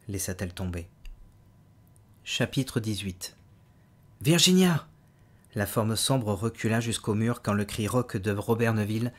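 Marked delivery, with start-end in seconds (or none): 4.2-4.9 a sudden loud cry of the name, high and strong; hoarse cry. Acted. Man jolting awake cries his dead wife's name, loud. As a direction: shout it out of sleep.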